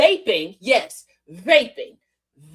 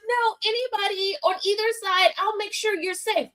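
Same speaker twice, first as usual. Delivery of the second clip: higher, prim reassuring voice; mocking Bondi; 7 semitones higher than usual. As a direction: parody her empty promise. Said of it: "Pam Bondi was like".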